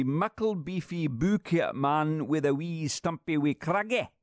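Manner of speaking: lilting mock-Scottish reading, a little high; Scottish accent. Radio host reading Scots Harry Potter, Scottish accent. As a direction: read it in a put-on Scots accent. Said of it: "Harry Potter been translated into Scots".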